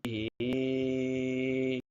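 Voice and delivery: बिही stretched very slowly; slow chanted बिही; drawn-out recitation. Shown as a demonstration. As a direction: hold the ई of बिही long. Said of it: "हा खड़ा जेर ई".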